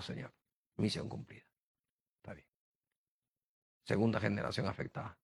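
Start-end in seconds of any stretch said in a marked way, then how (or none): 0.0-2.5 breathless whisper to God; whispered prayer. Real, not acted. Father watching his son preach whispers thanks to God. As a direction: barely breathe the words, moved.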